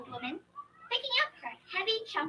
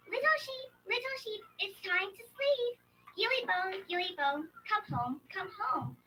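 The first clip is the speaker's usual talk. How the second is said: gentle call; 6 semitones higher than usual. Acted. Fox calling the sheep home, very gentle lullaby call. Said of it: "call them very gently".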